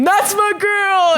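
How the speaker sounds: high, drawn-out holler from the crowd; cheering dad, big stretched-out yell; dad's proud scream. Retold or imitated. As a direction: imitate a dad yelling for his kid. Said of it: "my dad get up and scream".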